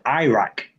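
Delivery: Iraq opening with the word "eye", EYE-rack; other people's Iraq. Shown as a demonstration. Showing the American how others say it, long-I Iraq. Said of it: "Other ones say Iraq".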